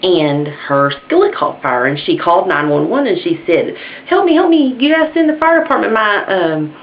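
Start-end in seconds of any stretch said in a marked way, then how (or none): 4.1-6.8 very high, frantic emergency-call wail; hammed-up panic. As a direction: play a panicking caller begging for help. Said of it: "Okay, ma'am, calm down".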